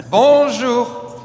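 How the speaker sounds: loud, high-pitched French "Bonjour"; loud greeting. Real, not acted. French pastor greeting the American congregation, loud calling out. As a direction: call it out to the whole room.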